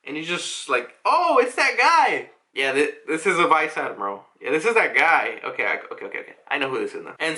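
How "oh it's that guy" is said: excited. Retold or imitated. Recognizing a character excitedly.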